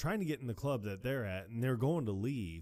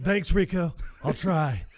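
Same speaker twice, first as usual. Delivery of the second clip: loud reply; 7 semitones higher, 11 dB louder, slower than usual. Retold or imitated. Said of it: "acted reply".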